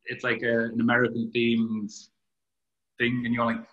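usual speaking voice

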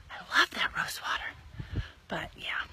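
hushed confiding whisper; whispered aside. Real, not acted. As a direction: whisper it like a secret. Whispered aside about rose water.

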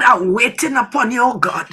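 ordinary delivery

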